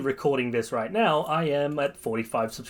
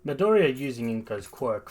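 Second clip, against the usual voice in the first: usual speaking voice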